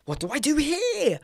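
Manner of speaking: high-pitched mock helpless voice; exaggerated high put-on puzzlement; mimicking a confused user. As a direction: squeak it like a baffled beginner. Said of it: "you're like this going".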